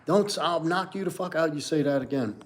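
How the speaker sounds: high-pitched confrontational challenge, acted; mock aggressive. Showing viewers what not to do, mock aggressive. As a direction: act out an aggressive threat without shouting. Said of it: "You're not being aggressive".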